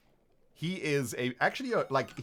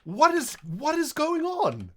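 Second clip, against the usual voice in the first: astonished; 10 semitones higher, 4 dB louder than usual. Real, not acted. Baffled by creeper.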